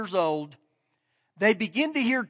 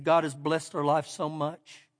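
tearful; 7 semitones lower, 7 dB quieter than usual. Retold or imitated. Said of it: "He has these tears coming down".

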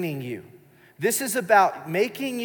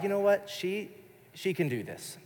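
usual speaking voice

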